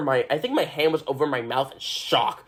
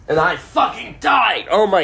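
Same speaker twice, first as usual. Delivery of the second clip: higher, louder outburst; overwhelmed shout; 8 semitones higher, 3 dB louder than usual. Real, not acted. Telling viewers how the ending hit her, a real outburst. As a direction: burst out with feeling. Reliving the show emotionally.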